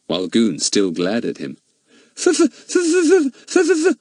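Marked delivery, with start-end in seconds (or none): 2.2-4.0 high, loud pretend stammer. A narrator's reading. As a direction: stammer as if scared. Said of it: "he stammered".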